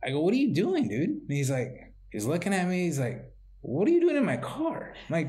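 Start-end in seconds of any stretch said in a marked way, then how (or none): 0.2-1.1 much higher, rising disbelief; can't believe what you're seeing, pitched high; incredulous question. Retold or imitated. Confronting a stranger at his car, high disbelief. 3.6-4.9 very high, outraged rising question; indignant stranger. Retold or imitated. Stranger accusing the real owner, very high indignant voice.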